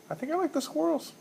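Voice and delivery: jokey high-voiced take on the pick; a sudden high squeak; squeaky high.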